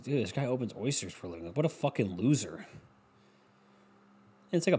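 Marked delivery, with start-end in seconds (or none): 0.0-2.7 low, soft mocking comment; imitated scornful aside. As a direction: say it under your breath, judging him. Acts out onlooker's private scorn.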